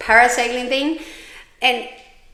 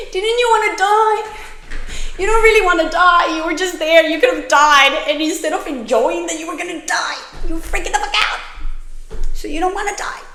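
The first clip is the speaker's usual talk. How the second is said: high, loud mocking self-rebuke; a high, loud retold scolding of herself; 10 semitones higher, 4 dB louder than usual. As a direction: berate yourself, shrill and loud.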